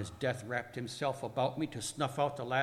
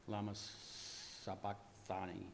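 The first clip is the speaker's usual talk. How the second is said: slow, very soft, low and halting; spent, drawn-out murmur; fading cry; 4 semitones lower, 12 dB quieter, slower than usual. Acted. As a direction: let the words fail as if dying. Dying cry fades after shouts.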